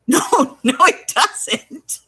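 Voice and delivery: high, slow laughing denial; laughing protest. Retold or imitated. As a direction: object through giggles. Laughing argument with the agent.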